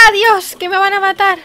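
high, loud panicked shout; alarmed cry. Real, not acted. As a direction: yell as enemies close in. Attacked in the jungle.

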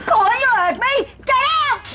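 high-pitched furious shout; angry dismissal. Acted. Woman throwing her rival out, angry dismissal.

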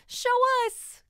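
put-on high, loud excited child; a boy begs to see the dragon; eager cry. A narrator's reading. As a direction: call it out, bursting with eagerness. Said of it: "cries Sam".

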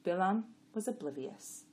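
ordinary delivery

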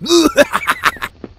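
hearty villain laugh; greedy laugh. Acted. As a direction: laugh with hungry glee.

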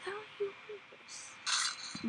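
slow whispered quote. Retold or imitated. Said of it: "It's like".

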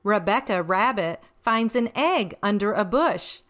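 plain reading voice

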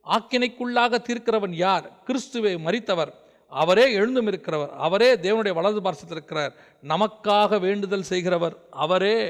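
slow, drawn-out declamation of a verse; scripture recitation. Acted. Preacher recites Romans to the congregation, slow declamatory reading. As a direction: proclaim each clause slowly and solemnly.

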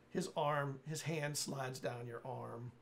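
ordinary delivery

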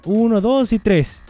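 ordinary talk, nothing marked

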